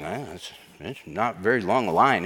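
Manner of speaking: low, soft, halting excuse; hesitant mumbled reply. Acted. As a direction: stall sheepishly, as a reluctant soldier. Acted reluctant soldiers answering David.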